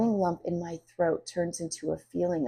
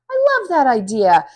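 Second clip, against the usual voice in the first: excited; 7 semitones higher, 10 dB louder than usual. Acted. Friend loves the idea.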